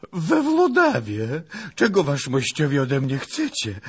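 high, loud, panicky servant; frightened cry. Acted. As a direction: cry out as a scared lad. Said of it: "wołał przestraszony Pacholek". (przestraszony krzyk)

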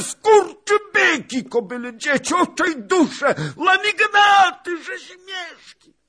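very high, loud, drawn-out ranting roar; frantic full-force yelling; roaring old beggar. A narrator's reading. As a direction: bellow a desperate tirade of abuse. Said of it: "ryczał dziad".

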